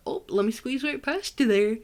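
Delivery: put-on a weak, half-hearted Wisconsin accent. Ohio podcaster doing Wisconsin people, in a faint Wisconsin accent. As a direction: lightly put on a Wisconsin accent, not quite landing it. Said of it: "don't have an accent like Wisconsin people do".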